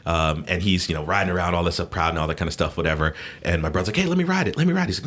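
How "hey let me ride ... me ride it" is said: put-on high-pitched begging kid; pleading brother. Brother pestering a kid for his motorcycle, pleading. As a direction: beg eagerly in a much higher voice.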